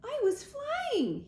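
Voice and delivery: very high, drawn-out excited squeak; slow, high little-bird squeak. A narrator's reading. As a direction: squeal it like a thrilled gosling. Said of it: "he squeaked".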